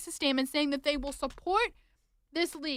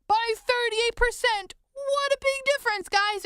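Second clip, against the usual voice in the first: high, singsong fake enthusiasm; mock-thrilled, pitched way up; sarcastic mock excitement; 8 semitones higher, 3 dB louder than usual. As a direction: sarcastically hype it up as wonderful news, drawn out then high. Sarcastic cheer over a smaller cut.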